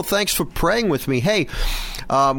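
usual speaking voice

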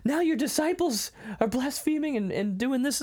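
hammed-up scolding in a high register; mock yelling. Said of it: "yelling at me like".

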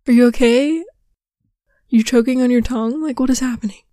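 loud, incredulous alarm; baffled outburst. Retold or imitated. Reacting to an over-mouthing lip talker, loud and baffled. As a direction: blurt it out, honestly thrown by what you see. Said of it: "and you're like".

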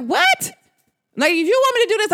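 shrill high "What?" of put-on shock; mock surprise. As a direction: play the stunned girlfriend, pitch way up. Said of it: "I'm like".

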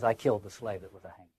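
low, soft and hurried recitation; quick, throwaway quote. Acted. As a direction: toss the quoted line off quickly, under your voice. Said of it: "as quickly as he did".

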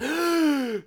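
sharp high gasp; shocked gasp. Pretend. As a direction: gasp in mock horror. Shocked by partner's answer.

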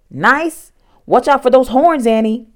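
loud, high incredulous warning; exasperated, high-pitched alarm. A narrator's reading. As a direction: raise your voice in disbelief and warn her. Jack alarmed, argues with Annie.

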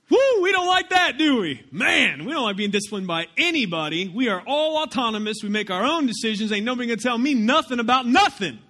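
loud, high, hammed-up indignation; mock defiant congregation. Preacher paraphrasing his congregation's attitude to church discipline, mock defiant. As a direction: whoop and protest like someone who refuses correction. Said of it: "I'm just paraphrasing most of your attitudes".

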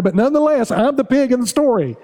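choked-up pastor admitting he is the pig; emotional. Real, not acted. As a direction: voice lifting with real emotion. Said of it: "I'm getting so emotional".

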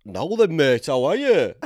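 loud, high dubbed Yorkshire greeting; exaggerated Yorkshire accent. Dub over his southern self, exaggerated Yorkshire accent. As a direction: turn the Yorkshire right up, hammed-up. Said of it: "Turn up the Yorkshire".

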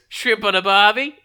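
loud, high cartoon Australian catchphrase; exaggerated Australian accent, rising at the end; Pyro. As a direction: burst in with a hammed-up Aussie call. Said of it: "his line as he walks into a room".

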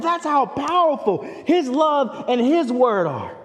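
loud, high, ringing sermon climax; soaring pulpit proclamation, loud and high; full-force preaching. Acted.

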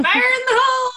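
high, hammed-up warning holler; playful high-pitched yell; mock battle shout. Mimics the line as battle cry.

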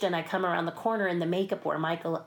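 normal talking voice

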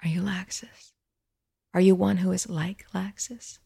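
whispered, barely breathed; hushed whisper. Acted. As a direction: ask it in a timid whisper, afraid to be heard. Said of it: "she dared not ask".

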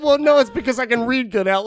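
loud, high boastful yell; mock shout. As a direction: shout the boast, half joking. Retold boast to daughter.

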